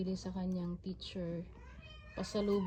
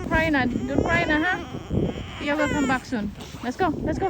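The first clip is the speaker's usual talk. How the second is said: high, louder comforting sing-song; 7 semitones higher, 9 dB louder than usual. Real, not acted. Mom telling James not to cry, high soothing baby talk. Mom consoles crying toddler.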